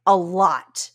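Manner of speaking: playfully loud, drawing out the words; mock yelling. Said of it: "you yell at them".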